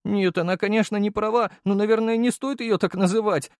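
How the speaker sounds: put-on high, thin young man's voice; mildly reproachful, pitched high; jilted deputy, calming down. A narrator's reading. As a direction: defend her in a thin, earnest voice.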